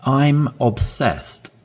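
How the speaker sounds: I'm hit high and slow, drawn out; stress on I'm. Shown as a demonstration. Teacher showing learners where to stress, slow and emphatic. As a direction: lean hard on I'm, slowly. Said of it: "Really, really wanna stress in here".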